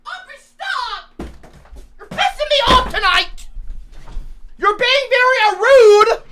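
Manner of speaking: exasperated scolding. Real, not acted. Husband tells off his wife in chat, exasperated scolding. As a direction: scold her, at the end of your rope.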